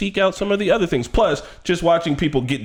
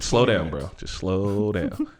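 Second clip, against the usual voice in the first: slow and softer, easing him down; soothing mock calm; slow, soft tease; 8 semitones lower, 5 dB quieter, much slower than usual. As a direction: say it slowly and hushed. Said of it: "teasing calm-down".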